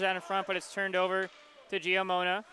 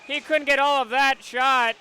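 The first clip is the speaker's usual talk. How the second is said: rising, louder play-by-play burst as the shot comes; excited call; 6 semitones higher, 8 dB louder than usual. Real, not acted. Announcer calling a scoring chance to the viewers, excited and louder. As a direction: call the backhand chance with a jump in energy.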